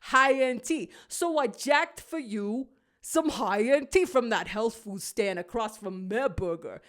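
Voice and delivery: a hint of a creaky old walrus; Willy the Walrus. A narrator's reading. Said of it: "hear Willy the Walrus".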